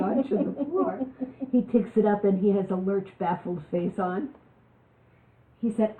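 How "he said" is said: ordinary delivery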